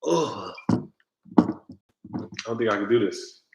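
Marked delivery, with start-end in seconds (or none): 0.1-0.5 soft low groan of dread; queasy groan. Real, not acted. Bracing for the dare shot, a soft queasy groan. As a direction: moan quietly, stomach turning.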